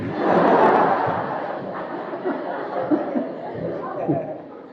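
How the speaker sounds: long high laugh. Real, not acted. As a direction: burst into a long helpless laugh. Bursts out laughing at own wordplay. (หัวเราะลั่นเสียงสูงยาว)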